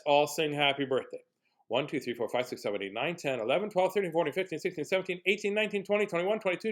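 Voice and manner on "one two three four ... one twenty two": rattled-off counting, climbing in pitch; rapid count-in. Pretend. Reader counts to forty-two before singing, rattled off rising.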